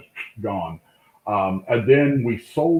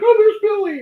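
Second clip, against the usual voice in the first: much higher, louder delighted cry; crowd excited; 9 dB louder than usual. Retold or imitated. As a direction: squeal it like fans spotting a star. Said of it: "everybody's like".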